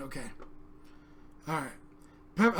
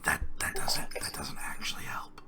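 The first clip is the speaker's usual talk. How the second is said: amused whispered correction; whispering; 8 dB quieter than usual. Real, not acted. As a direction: keep whispering while explaining. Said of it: "Why are we whispering?".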